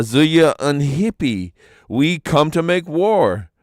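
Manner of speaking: a small stranger announcing war, slow and loud; stranger's declaration. A narrator's reading. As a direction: announce war slowly and grandly. Little stranger man declares war.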